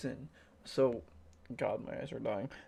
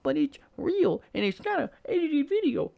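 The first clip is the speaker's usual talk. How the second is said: mock a gullible fan, pitched up; mocking high voice; 13 semitones higher, 6 dB louder than usual. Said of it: "let's be like".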